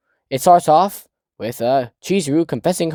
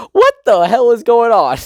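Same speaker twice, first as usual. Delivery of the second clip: high, louder baffled cry; incredulous outburst; 10 semitones higher, 6 dB louder than usual. Real, not acted. Reacting to the sperm-sniffing fact, high incredulous cry. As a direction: shout it in disbelief.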